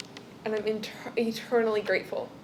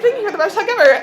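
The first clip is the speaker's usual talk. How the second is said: laughing gratitude; 8 semitones higher, 9 dB louder, faster than usual. Retold or imitated. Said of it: "I was like".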